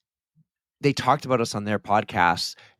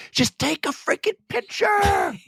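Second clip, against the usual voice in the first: high, drawn-out yell of mock frustration; exasperated mock shout. Nagging co-host to film some content, mock-exasperated yell. Voicing his annoyance about content.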